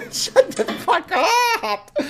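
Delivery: very high, drawn-out shriek; exasperated scream. Real, not acted. Ranter yelling back at the suspect's police interview, exasperated scream. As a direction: scream over the recording, half laughing.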